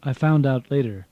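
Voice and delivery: ordinary delivery, nothing marked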